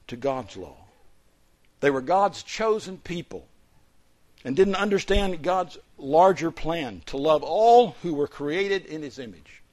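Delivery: loud, ringing sermon delivery; forceful preaching. Acted. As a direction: preach it out to the congregation.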